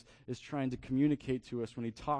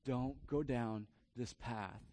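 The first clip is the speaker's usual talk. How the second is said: slow, soft and lower; gentle grave warning; 4 semitones lower, 6 dB quieter than usual. Retold or imitated. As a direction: warn a loved one softly, pausing between phrases. Mother's gentle warning, retold.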